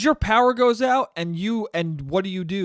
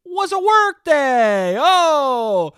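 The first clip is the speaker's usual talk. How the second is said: loud, drawn-out sarcastic revelation; mock amazement; 8 semitones higher, 7 dB louder, much slower than usual. Host mocking himself over a work day, mock amazement. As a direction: belt out the obvious as a discovery. Mocking his own obvious realization.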